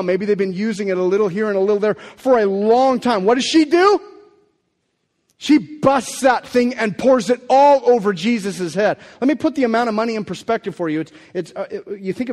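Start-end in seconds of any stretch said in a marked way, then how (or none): 3.2-9.0 louder, slower, driving the story home; rising preacher emphasis. Real, not acted. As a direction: let it ring out as she breaks the flask. Climax of the anointing story.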